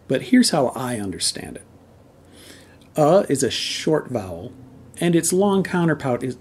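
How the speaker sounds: ordinary talk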